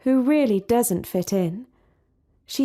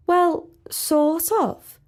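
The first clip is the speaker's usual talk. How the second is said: slow, higher, unsure; hesitant Sally; 8 semitones higher, much slower than usual. A narrator's reading. Sally hedging to the princess, slow and higher. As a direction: drag it out, caught off guard.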